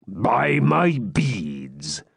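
put-on very slow, drawn-out old hermit; creaky old hermit. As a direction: stretch it out like a tipsy old friar. Hermit startled mid-song.

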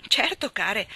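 a warm surprised cry of welcome; lightly voiced exclamation. A narrator's reading. Mrs Spencer welcoming unexpected guests, light glad exclamation. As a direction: greet them with glad surprise. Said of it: "esclamò".

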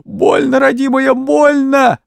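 high, loud pained squeal. A narrator's reading. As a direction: cry out that she'll die. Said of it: "девка взвизгнула".

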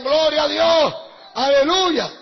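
shouted gloria a Dios; loud praise. Retold or imitated. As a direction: proclaim it like a whole church on its feet. Congregation rising, shouting praise.

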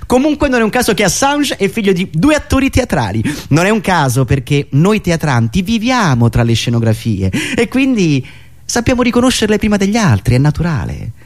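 ordinary delivery

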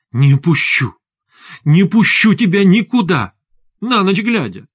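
high, insistent outcry; distraught wife refusing to let go; frantic protest. A narrator's reading. Wife blocks husband from leaving.

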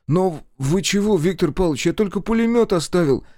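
put-on a bewildered boy caught off guard; растерянно. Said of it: "растерялся Колька". (растерянно и высоко)

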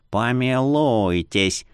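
put-on slow, slurred drunk drawl; tipsy merchant lazily tossing it off; town drunk. A narrator's reading. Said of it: "пьяно бросил Бара".

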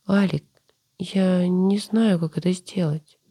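high, slow, close to tears; tearful pleading. Acted. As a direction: plead through held-back tears. Sister pleading, near tears.

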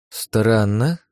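drawn-out, puzzled musing; thoughtful. A narrator's reading. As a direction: muse over it with a questioning lift. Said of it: "задумчиво сказала Ирена".

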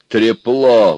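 loud indignant jeer. A narrator's reading. Everyone reacts to Аристарх's old promise, indignant. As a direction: throw it out as a reproach. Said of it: "возмутились все".

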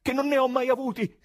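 high, loud, despairing cry; frantic high-pitched protest; desperate shout. A narrator's reading. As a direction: shout your innocence in despair. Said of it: "gridò disperato il presidente".

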